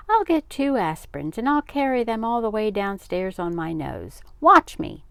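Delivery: put-on high, loud child showing off; excited boasting. A narrator's reading. Boy offering father a stunt, excited. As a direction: build to a shouted finish.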